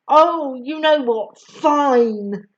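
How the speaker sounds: mildly angry snap; snapping. A narrator's reading. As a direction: snap it with exasperation. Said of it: "snaps Harry".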